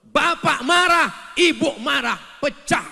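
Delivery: high, loud, slow proclamation; shouting. Real, not acted. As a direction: hammer each phrase. Loud preaching outburst. (seruan lantang)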